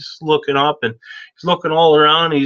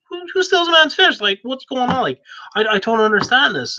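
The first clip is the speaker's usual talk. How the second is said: who on earth does that, pitched way up; baffled outrage; 11 semitones higher than usual. Acted. Boy outraged the eagle stole his fish, high disbelief.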